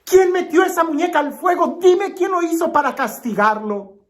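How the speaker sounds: high-pitched, urgent questioning; acted parental alarm, pitch held way up; dismayed father demanding. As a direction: demand answers as an upset father. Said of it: "consternado, le pregunta a la niña".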